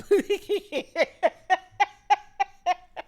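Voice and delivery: loud high burst of laughter; real laughter at the story. Real, not acted. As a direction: break into a loud cackle. Laugh after the retold retort.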